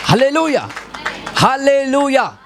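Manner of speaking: high-pitched hallelujah shouts, the second drawn out; preacher's high call to the crowd; rallying shout. Real, not acted. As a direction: rouse the congregation to answer. Preacher calls congregation for response.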